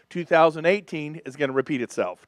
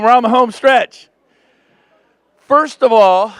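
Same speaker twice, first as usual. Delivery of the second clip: usual speaking voice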